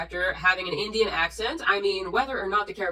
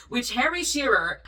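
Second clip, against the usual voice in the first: ordinary talk, nothing marked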